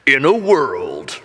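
slow movie-trailer announcer; imitated trailer narrator, words stretched slowly. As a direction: draw it out like a trailer voice-over. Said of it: "if it was from a movie trailer".

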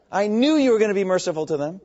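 resentful complaint at mercy; Jonah's angry attitude. Acted. Jonah resenting God's mercy, angry. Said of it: "That's Jonah's attitude".

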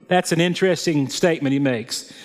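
ordinary talk, nothing marked

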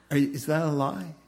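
ordinary talk

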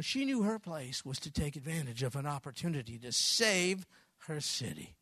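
ordinary talk

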